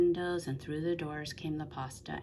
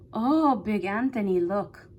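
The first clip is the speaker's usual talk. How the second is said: higher, louder, a nun calling in alarm; a sister calling out; 5 semitones higher, 9 dB louder than usual. A narrator's reading. A convent sister alerts Big Anthony, higher and louder call. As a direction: call him over in a light, raised voice. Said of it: "a sister from the convent said".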